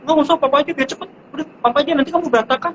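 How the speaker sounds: imitating a fussy parent shooing the kid off; lightly hammed-up dismissive parent; mock impatient parent. Impatient parent refusing child's help.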